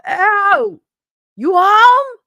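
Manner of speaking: very high, loud screechy holler; window call. Retold or imitated. Kid outside a friend's window, high loud screeching call. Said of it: "go outside the window and go".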